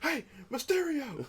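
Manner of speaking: high, slow promo call; wrestling promo. Retold or imitated. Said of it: "the one I said".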